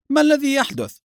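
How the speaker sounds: high-pitched alarmed cry; shouted question. A narrator's reading. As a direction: call out the question high and urgent. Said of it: "صاح سائق سيارة الإسعاف". (صيحة استفهام بنبرة عالية)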